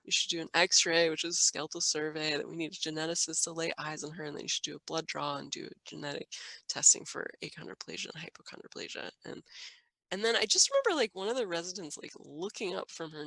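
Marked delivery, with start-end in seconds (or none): none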